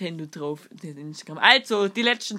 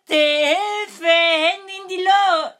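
hammed-up cry for help; 10 semitones higher, 6 dB louder, much slower than usual. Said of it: "joke punchline acted".

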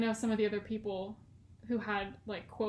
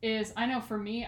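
normal talking voice

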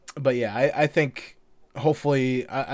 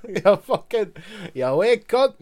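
high, loud Irish brogue through a laugh; Irish accent; 7 semitones higher, 4 dB louder than usual. Retold or imitated. Cat leprechaun grumbling, in an Irish accent. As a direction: repeat it in a hammed-up Irish lilt. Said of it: "have an Irish accent".

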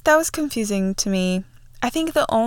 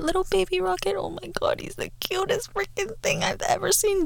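high, breathy squeal dissolving to a whisper; high, hushed adoring coo; gushing over cuteness; 9 semitones higher, 4 dB quieter than usual. Real, not acted. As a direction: coo over the cutest little thing. Gushing over baby Rocket.

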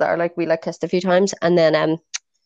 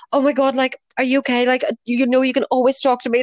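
higher, gushing supportive voice; raised pitch, acting out a worried message; mock-earnest concern; 6 semitones higher than usual. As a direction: imitate an over-caring friend. Re-enacts her earnest supportive message.

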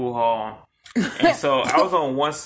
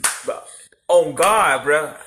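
tough-guy voice; 5 dB louder, much slower than usual. Retold or imitated. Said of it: "my defense mechanism is to sound tough".

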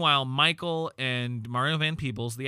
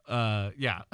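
ordinary talk

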